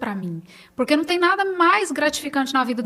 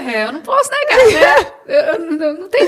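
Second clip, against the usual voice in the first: laughing; 6 semitones higher, 10 dB louder than usual. Real, not acted. Laughing admitting her sons are handsome.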